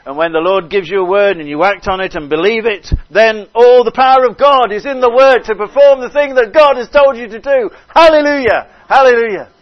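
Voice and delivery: high, loud, climactic proclamation; full-force preaching. Real, not acted. Preacher proclaiming God's power in the word, full-force preaching. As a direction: build to shouted hallelujahs.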